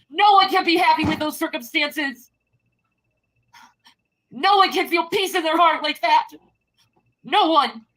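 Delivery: real despairing yell; anguished shouting. Real, not acted. Escalating tearful rant at chat.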